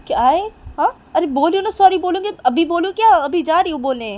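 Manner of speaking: high, loud hurried assurance; acted agitated giving in; exasperated placating. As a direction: plead that you are doing it right now. Frantic agreement in acted quarrel.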